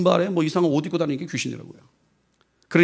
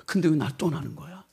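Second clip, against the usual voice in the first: hushed plea; 7 dB quieter than usual. Retold or imitated. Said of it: "막 그래요".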